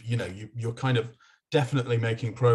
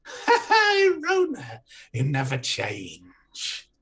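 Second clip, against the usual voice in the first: mocking jester; 4 dB louder, slower than usual. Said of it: "looks down at you and goes".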